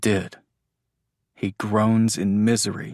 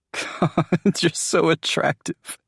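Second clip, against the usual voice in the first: higher, amused sarcasm; laughing tease; 8 semitones higher, slower than usual. Acted. Andrew mocks Jameson's snot, amused and higher. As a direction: tease her while still laughing.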